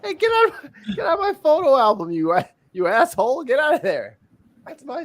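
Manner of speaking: shrill-pitched get out; high protesting yell. Real, not acted. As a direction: shout him out of the album. Friend in his photo album.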